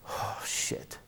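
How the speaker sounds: breathy whispered oh shit; whispered curse. Acted. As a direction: whisper it under your breath. Sees the crowd gathering.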